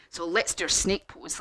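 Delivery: plain reading voice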